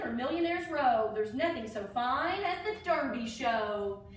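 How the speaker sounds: lilting rhyming storybook read; reading to children. Acted. Teacher reading a Derby alphabet book to children, storybook voice. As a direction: read each verse like a picture book for kids.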